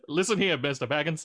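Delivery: hammed-up old-Hollywood wizard warning Mister Baggins; Mid-Atlantic wizard. A friend doing Gandalf as an old Hollywood star, in a Mid-Atlantic accent. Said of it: "all my dwarves now are Mid-Atlantic".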